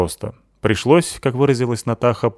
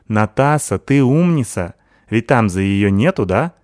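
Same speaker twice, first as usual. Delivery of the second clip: put-on Sykil's accented voice; 2 semitones higher, 4 dB louder than usual. Sykil praising Natasha, lisping accented girl voice.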